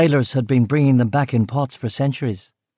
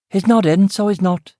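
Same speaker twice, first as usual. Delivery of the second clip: put-on annoyed West Belfast accent, pitched higher; pissed-off West Belfast; 8 semitones higher than usual. Cook annoyed at the door, in a cross West Belfast accent. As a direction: snap it in a Belfast cook's brogue. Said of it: "she said in a pissed-off West Belfast accent".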